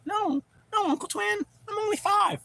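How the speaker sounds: put-on very high, small child's voice; little kid voice. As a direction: play a five-year-old pleading with his uncle. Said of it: "I started talking like that".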